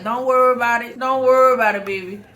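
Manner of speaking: loud playful sassy dismissal; answering the ladies with a loud teasing brush-off. Retold or imitated. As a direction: brush them off with swagger. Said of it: "I'm gonna be like".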